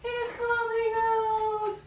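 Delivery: slow stretched-out yell; drawn-out scream. Real, not acted. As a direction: wail it out slowly in disgust. Yogurt squirting out, screaming.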